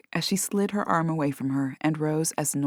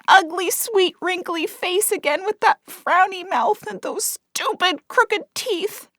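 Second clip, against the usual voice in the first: much higher, desperate begging; tearful pleading; 11 semitones higher than usual. A narrator's reading. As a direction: plead through tears. Cat pleading tearfully for Lola.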